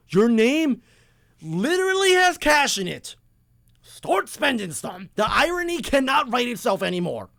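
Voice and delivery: high, exasperated scolding of the general manager; heated. Real, not acted. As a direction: get worked up and jab at him. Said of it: "I'm getting so heated right now".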